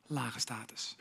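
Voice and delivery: low status whispered. Shown as a demonstration. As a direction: sink it to a whisper. Whispered contrast.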